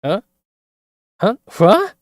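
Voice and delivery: loud squeaky disbelief; stunned what. Real, not acted. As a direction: jolt up high in real shock. Shocked at villain's words.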